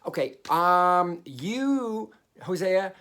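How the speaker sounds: high, loud, drawn-out summons; loudly calling on the prophet in God's role; God addressing Hosea. Acted. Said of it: "he's basically saying".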